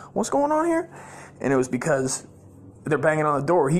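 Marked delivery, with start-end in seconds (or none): 0.1-0.9 put-on very high childlike question; confused little kid. Little kid amid the family uproar, confused high voice. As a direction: squeak it like a baffled small child. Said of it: "I'm just like a little kid".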